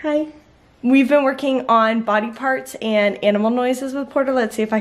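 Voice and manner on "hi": ordinary talk, nothing marked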